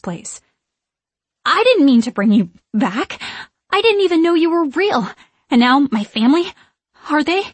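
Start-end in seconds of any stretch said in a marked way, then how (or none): 1.5-7.4 frightened pleading. A narrator's reading. Scared girl confronts witches.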